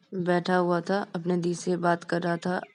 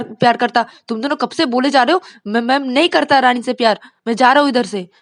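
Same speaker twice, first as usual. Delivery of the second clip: much louder and higher, fast and stammering; flustered angry outburst; 6 semitones higher, 11 dB louder, faster than usual. Acted. Teen boy to his teasing friends, flustered angry outburst. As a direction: blurt it out and storm off. Vikas storms off denying.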